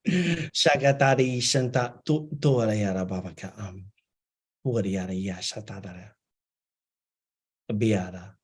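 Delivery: flowing channeled chant of unknown words; light language. Acted. Channeler voicing beings for the guest, in a flowing chant. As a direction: let the syllables stream as if received.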